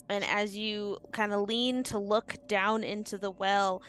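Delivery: normal talking voice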